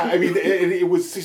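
ordinary talk, nothing marked

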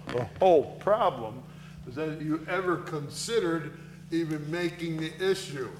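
ordinary delivery

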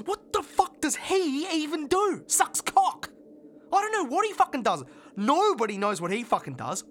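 loud, high, scornful outburst; furious rant. Acted. Furious rant about Gavin.

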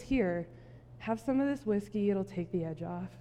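low, soft, casual offer; chill. Acted. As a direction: play the laid-back guy, quietly soothing. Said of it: "he's, like, chill".

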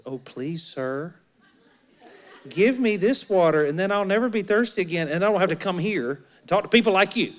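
mock pleading that builds into quick sarcasm; sarcastic. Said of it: "I hear the sarcasm here in this woman's voice".

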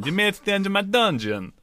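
put-on high Boston accent voice. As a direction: greet them with a thick Boston accent. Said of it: "I almost went Boston".

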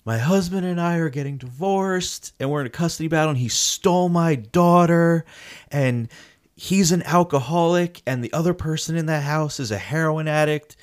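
imitated teary woman pouring out her troubles; imitating her crying. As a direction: play her tearful rant as a retold imitation, pitched well up. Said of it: "starts crying".

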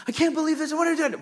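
mock outrage at the wife over the sunny seats; exasperated outburst. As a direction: blurt it out in disbelief, half-joking. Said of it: "I'm angry".

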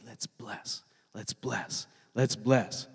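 soft, low, feeble chant; half-hearted rallying. Acted. Trying to get a crowd to bless, soft half-hearted chant. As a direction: start very soft, a rally nobody joins. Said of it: "It's hard to get a crowd to say".

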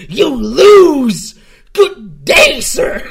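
high shouted Wonka "You lose. Good day, sir."; a furious Wonka dismissing a guest at full volume; Willy Wonka impression. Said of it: "a Willy Wonka impression".

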